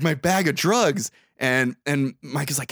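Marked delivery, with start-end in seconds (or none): none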